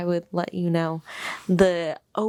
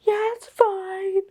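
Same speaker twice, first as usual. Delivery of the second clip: fake okay; 12 semitones higher, slower than usual. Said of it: "I would have been like".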